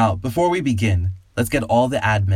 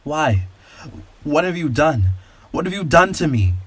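higher horrified cry of a man; rising horror in a higher man's voice; shocked horror; 6 semitones higher than usual. A narrator's reading. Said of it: "The look of shock and horror".

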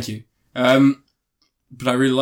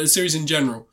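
ordinary talk, nothing marked

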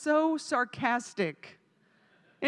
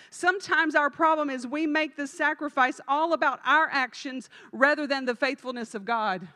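normal talking voice

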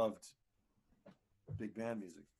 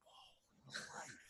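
mock awed whisper; 14 dB quieter than usual. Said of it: "And I'm like".

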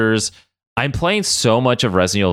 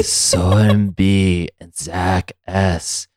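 slow, low spooky haunted house voice; Caliban voice; 3 semitones lower, much slower than usual. Pretend. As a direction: creep out each name slowly, like a haunted house host. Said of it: "do that Caliban voice again".

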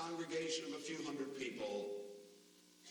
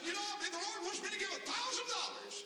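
excited pledge; 13 semitones higher, faster than usual. Retold or imitated. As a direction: blurt out the gift with eager joy. Man jumps up to pledge.

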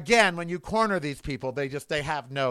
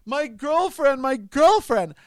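much higher, mock sobbing cry of grief; imitating grief-stricken wail; 9 semitones higher, 6 dB louder, slower than usual. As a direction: retell his anguished wail, pitched well up. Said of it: "he's all".

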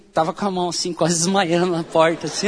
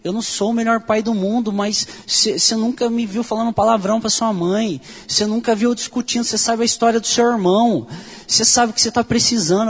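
father's outburst retold, pitched higher; retold yell; 6 semitones higher than usual. Father shouting at his drug-using son, high retold yell. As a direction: re-enact dad yelling at his son, raised and high. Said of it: "o meu pai deu um grito".

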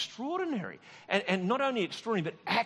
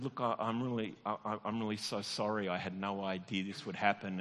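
sorry and soft; 7 semitones lower, 5 dB quieter than usual. Retold or imitated. Comforting the weeping man.

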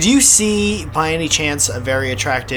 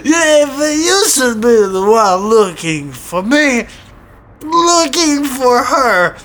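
higher, slow, hammed-up Russian threat; sketchy Russian accent; 8 semitones higher, 5 dB louder, slower than usual. Imagined Russian neighbor threatens to beat him up, in a hammed-up Russian accent. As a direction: drag out every word like a cartoon Russian heavy. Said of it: "This is what I hear".

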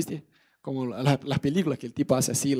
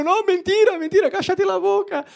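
much higher, louder desperate protest; frantic denial; 13 semitones higher, 4 dB louder than usual. Acted. Amán denying the gallows story, frantic and loud. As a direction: shout down the accuser in panic. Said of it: "Yo me imagino".

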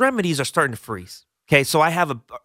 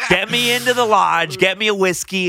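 higher-pitched comic pleading; hammed-up call for rescue; fed-up demand; 5 semitones higher than usual. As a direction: act out being done with it all. Acts out his fed-up demand.

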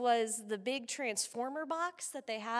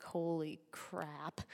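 slow, much softer stunned murmur; stunned mom; 6 semitones lower, 14 dB quieter, much slower than usual. Retold or imitated. Mom seeing the wreck, stunned and soft. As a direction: breathe it out in shock. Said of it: "she's like".

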